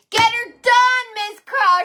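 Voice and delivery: high, loud cheer; hype shout. Real, not acted. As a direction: pump up the viewer.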